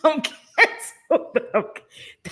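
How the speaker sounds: real laughter breaking up the words; laughing hard. Real, not acted. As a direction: talk helplessly through your laughing fit. Cracking up at own blunder.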